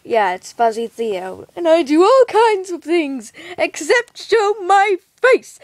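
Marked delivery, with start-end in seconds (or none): 1.5-5.6 playful mystery voice. Acted.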